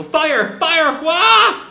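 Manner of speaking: high, loud mock crowd screaming fire; panicked townspeople. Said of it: "we hear, like, the townspeople".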